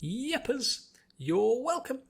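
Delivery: very high bubbly chirp; giggly chirp. A narrator's reading. Pinkie answering Applejack's thanks, giggly. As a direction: chirp it cheerfully. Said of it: "Pinky giggled".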